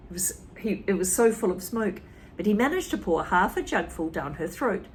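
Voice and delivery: usual speaking voice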